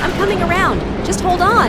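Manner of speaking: loud, high, shouted over engine noise; urgent shout. Acted. Pilot shouting to her wingman over the radio, urgent. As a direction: yell it over the roar of the jet.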